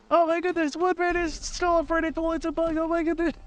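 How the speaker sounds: high mock-shocked classmate voice; mocking accuser. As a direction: taunt him like a gleeful kid.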